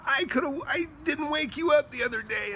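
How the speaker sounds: high, exasperated self-reproach; retold frustration. Buyer regretting he let seller sleep, exasperated. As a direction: re-enact kicking yourself, voice way up. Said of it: "I was so mad".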